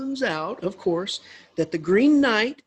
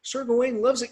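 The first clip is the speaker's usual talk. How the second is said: ordinary delivery, nothing marked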